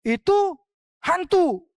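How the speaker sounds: high, loud cry of fright; frightened cry. Acted. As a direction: shriek it out in terror. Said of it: "dan berseru".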